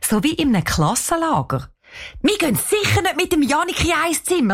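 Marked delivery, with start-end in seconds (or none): 2.2-4.5 put-on high, appalled outcry of a girl; entsetzter Ausruf. A narrator's reading. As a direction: protest in horror, pitched up. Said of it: "ruft Cheyenne entsetzt". (entsetzt rufen, hoch)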